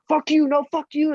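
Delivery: high-pitched acted back-and-forth shouting; high, heated mock yelling; mock screaming argument. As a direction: throw out a mock movie row. Imitates screaming movie argument.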